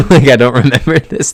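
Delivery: amused, laugh breaking into the words. Real, not acted. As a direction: laugh at yourself while admitting it. Laughs at own hazy memory.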